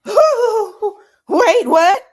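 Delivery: very high, loud, laughing gasp of disbelief; real stunned laughing squeal; shocked shriek. Real, not acted. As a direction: squeal like you can't believe it. Reacting to the comeback.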